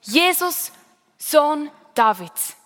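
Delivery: a high, slow call across a crowd; crying out. Acted. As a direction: call out to him, drawn out and pleading. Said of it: "rief er".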